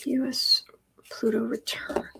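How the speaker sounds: hushed whisper to herself. Real, not acted. Reader whispers the question while drawing cards. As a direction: murmur it under the breath.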